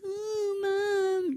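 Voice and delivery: put-on high, crying "Oh, Mom" from a whining kid; whiny kid plea. Kid whining to mom to get sympathy, high whimper. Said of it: "sucked into them being like".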